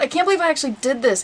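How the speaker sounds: normal talking voice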